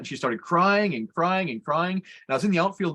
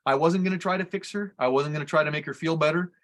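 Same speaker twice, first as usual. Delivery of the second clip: ordinary talk, nothing marked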